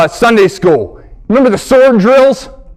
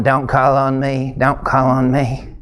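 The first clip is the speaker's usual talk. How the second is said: much softer, lower plea; praying under breath; 10 semitones lower, 7 dB quieter than usual. Retold or imitated. Boy hoping the teacher won't pick him, murmured low and soft. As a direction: mutter it low so no one hears. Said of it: "I'm sitting there going".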